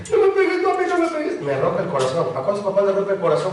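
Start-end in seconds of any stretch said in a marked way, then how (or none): 0.1-1.4 put-on high, pleading little-boy voice; child pleading. Preacher imitates his five-year-old son begging, high and pleading. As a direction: beg like a small child. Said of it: "Y cuando me dice".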